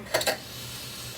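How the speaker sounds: quiet steadying sigh; long sigh. Real, not acted. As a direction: breathe out to calm down. Calming herself down.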